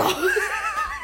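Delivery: sudden high-pitched laugh bursting out; laughing helplessly at the memory; burst of laughter. Real, not acted. Recalling a class where she couldn't stop laughing, a high, helpless burst of laughter.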